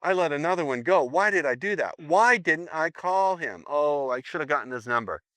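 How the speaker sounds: imitated woman berating herself; kicking herself. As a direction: wail with hammed-up regret, drawing out the why. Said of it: "start to kick herself and go".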